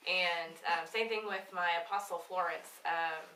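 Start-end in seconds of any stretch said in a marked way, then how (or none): none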